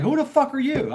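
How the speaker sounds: put-on high-pitched indignant challenge from a kid; retold yelling. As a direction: confront an intruder, pitch jumping up. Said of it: "I'm yelling, cussing, screaming".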